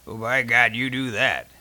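loud, amused burst; big amused outburst from the sergeant; guffawing. A narrator's reading. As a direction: exclaim through a hearty guffaw. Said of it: "then guffawed".

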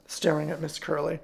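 ordinary delivery, nothing marked

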